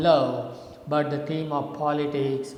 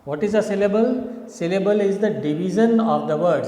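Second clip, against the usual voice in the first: ordinary talk, nothing marked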